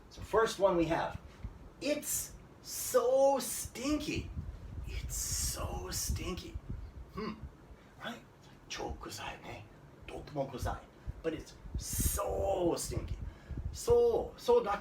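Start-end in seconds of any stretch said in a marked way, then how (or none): 1.8-6.5 "so" drawn out long and high; very slow, exaggerated stress on "so"; emphatic SO. Shown as a demonstration. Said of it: "make your speech a little stronger". 11.4-13.1 "so" held long for emphasis; slow, stretched stress on "so"; emphatic SO. Shown as a demonstration. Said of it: "make your speech a little stronger".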